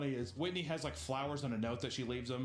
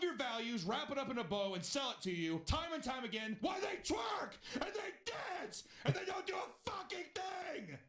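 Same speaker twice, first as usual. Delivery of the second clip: furious shout; 10 semitones higher than usual. Real, not acted. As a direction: rage at the scammers until the voice breaks. Angry rant about crypto scammers.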